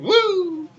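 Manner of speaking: high, drawn-out "woo"; long wrestler-style whoop. Acted. Mimicking Ric Flair's catchphrase woo.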